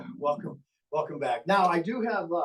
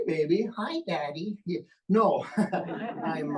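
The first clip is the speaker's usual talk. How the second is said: normal talking voice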